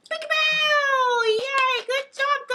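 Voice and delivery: very high, loud, stretched peekaboo and cheer; excited peekaboo. Real, not acted. Playing peekaboo with the kids, very high and loud. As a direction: burst out with it.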